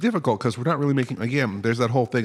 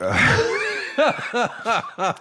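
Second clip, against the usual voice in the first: high laugh; 12 semitones higher than usual. Real, not acted. Reacts to forehead joke.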